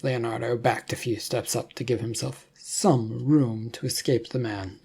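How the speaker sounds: plain reading voice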